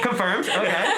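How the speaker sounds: high, loud laughing disbelief. Retold or imitated. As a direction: laugh the words out.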